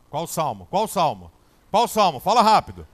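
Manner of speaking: building from calm to loud panic; panicked building. Retold or imitated.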